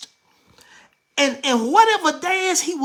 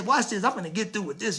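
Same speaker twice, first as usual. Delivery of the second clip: ordinary talk, nothing marked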